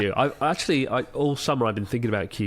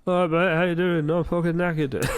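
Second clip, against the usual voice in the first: put-on old British accent; 6 semitones higher than usual. Newcomer greeting people at the airport, broad old British accent. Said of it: "my accent definitely changed when I moved here".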